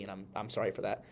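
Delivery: ordinary talk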